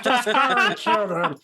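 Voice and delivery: barked order. Acted. Goblin captain ordering the attack. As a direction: shout the command at the troops. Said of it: "he's like".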